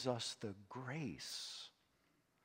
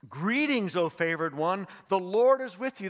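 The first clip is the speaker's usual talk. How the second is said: high, loud joyful announcement; angel's greeting; 7 semitones higher, 10 dB louder than usual. Acted. Angel proclaims greeting to Mary, loud and joyful. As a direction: burst in with glad news.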